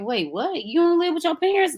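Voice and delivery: high-pitched, incredulous questioning; retold disbelief. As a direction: act out puzzled shock at a friend. Acting out her disbelief at friends.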